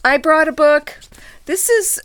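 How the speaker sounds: loud and excited. Real, not acted. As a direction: announce it happily.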